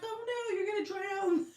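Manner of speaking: high mock-panicked warning to a nursing baby. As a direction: squeal it like a startled mom. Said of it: "and I'm like".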